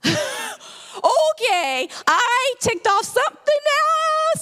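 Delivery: stunned, drawn-out discovery; startled realization. Retold or imitated. Sudden realization after the scare.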